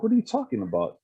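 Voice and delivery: high-pitched incredulous retort; imitating an annoyed comeback; retold indignation. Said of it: "That just pissed me off".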